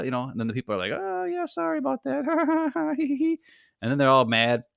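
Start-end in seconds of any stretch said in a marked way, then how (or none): 0.9-3.4 very high, sing-song fake sorry; a squeaky, hammed-up gate agent; mock sheepish apology. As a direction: ham up a limp apology ending in a giggle.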